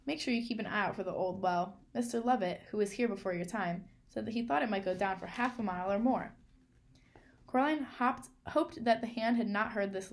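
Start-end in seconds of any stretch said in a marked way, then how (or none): none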